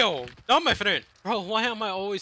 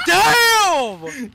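loud excited yell; hyped gamer bro; 6 semitones higher, 7 dB louder than usual. Real, not acted. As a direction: shout it out hyped. Shouted after a hit.

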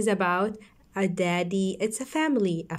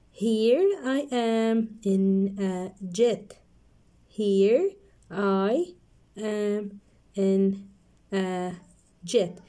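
slow, drawn-out line; slow reading; much slower than usual. Shown as a demonstration. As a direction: stretch it for kids. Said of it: "So let's read".

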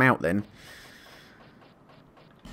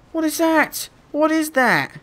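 1 panicked. Real, not acted. Panicking in the fog.

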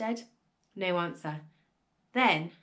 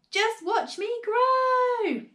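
high, loud, stretched-out cry; boastful shout; 12 semitones higher, 5 dB louder, much slower than usual. A narrator's reading. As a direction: crow it out, stretching the last word. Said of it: "she shouted".

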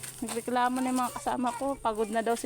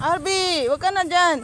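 calling out; 5 semitones higher, 9 dB louder than usual. Real, not acted. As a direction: shout to stop him. Calling child away. (pasigaw na pagtawag)

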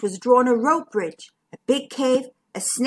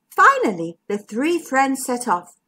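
usual speaking voice

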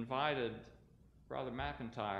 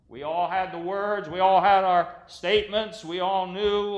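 raised, louder pulpit voice; impassioned preaching; 8 semitones higher, 15 dB louder than usual. Real, not acted. Preacher recalling his hot anger over the war, impassioned preaching. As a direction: drive each "we all" out to the congregation.

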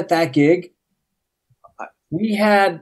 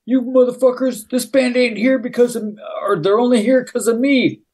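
higher, pushy mock tough guy; imitating a skater picking a fight; hammed-up bragging threat; mock aggressive rant; 6 semitones higher than usual. Thief comes at him.

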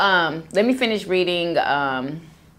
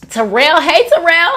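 louder, higher, calling a name; 8 semitones higher, 6 dB louder than usual. Real, not acted. Greeting a viewer named Terrell, calling out. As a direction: call across to him.

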